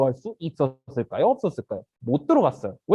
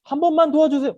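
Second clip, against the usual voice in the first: high, loud plea; begging; 9 semitones higher, 6 dB louder than usual. Acted. Beggar calling to passers-by at the temple, begging. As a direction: plead for help from passers-by. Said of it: "평소대로 이렇게 구걸을 하는 거예요".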